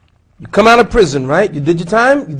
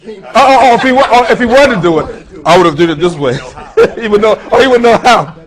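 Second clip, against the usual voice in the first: laughing through the words, louder; chuckling while correcting himself; 7 semitones higher, 12 dB louder than usual. Real, not acted.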